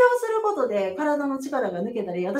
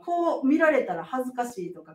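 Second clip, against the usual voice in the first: normal talking voice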